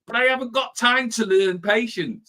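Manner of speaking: high, indignant protest; playing the impatient self for a laugh; mock impatience. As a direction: snap back, pretending to be too busy.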